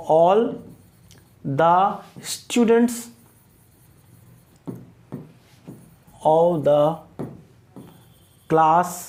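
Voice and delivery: long pauses between each word, fading at the end; word-by-word dictation. Shown as a demonstration. Teacher pausing after each word for note-taking. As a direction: dictate it so they can write it down. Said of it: "dictating word by word".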